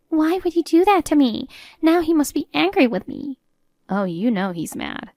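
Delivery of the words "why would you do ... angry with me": higher-pitched, upset mother-in-law voice; dismayed mother-in-law. Acted. Mother-in-law fears her husband's anger, dismayed and high.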